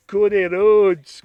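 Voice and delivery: loud, slow, put-on CODY RHODES; wrong-sounding voice. Naming the pairing, slow put-on wrong voice. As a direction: stretch out Cody Rhodes in a mock accent. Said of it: "doesn't sound like that".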